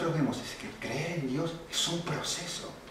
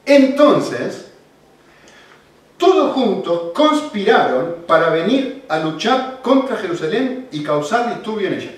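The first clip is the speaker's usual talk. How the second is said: full-force preaching; 8 semitones higher, 12 dB louder than usual. Acted. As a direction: preach it out loud to the whole church. Preaching to the congregation.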